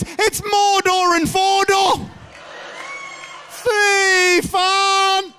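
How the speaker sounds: loud, high shouted disbelief; shouted outrage. Retold or imitated. Comic shouting at the neighbours barging in. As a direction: shout it out in shock.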